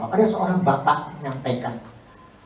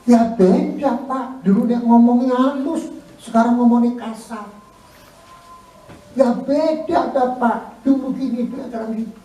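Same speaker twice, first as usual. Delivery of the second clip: louder, higher mock complaint; complaining spouse; 7 semitones higher, 5 dB louder than usual. Preacher voices a spouse complaining the partner changed, louder. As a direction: whine it to the pastor, pitched up.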